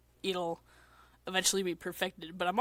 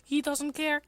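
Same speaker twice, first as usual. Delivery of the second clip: put-on a bit higher boy voice; lightly voiced Len; 8 semitones higher than usual. Len brushing Miku off, lightly voiced. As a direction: shrug it off as a Vocaloid boy. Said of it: "This is a voice acting stream".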